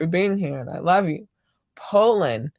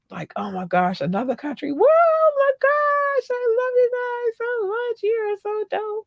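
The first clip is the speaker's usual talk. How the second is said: ecstatic inner voice. Acted. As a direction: gush in an over-the-top falsetto. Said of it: "that's really how it goes in my head".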